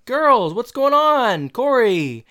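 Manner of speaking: high, loud, drawn-out hail; imitated calling out. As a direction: call across the room in mimicry. Retold walk-in calling out.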